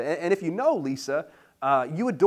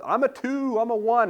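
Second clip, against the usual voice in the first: lightly voiced, higher chatty call-outs; chat comments; 8 semitones higher, 5 dB louder than usual. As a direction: chirp out your number like a chat comment. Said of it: "everybody ... in the chat saying what the number".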